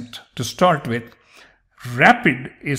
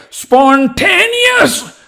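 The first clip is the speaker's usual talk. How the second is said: mock strained shout, much higher and drawn out; struggling, straining; 9 dB louder, much slower than usual. Shown as a demonstration. Teacher showing what not to do, loud straining spontaneous. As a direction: force spontaneous out loudly with exaggerated effort. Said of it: "You're struggling, like, you know, you're straining".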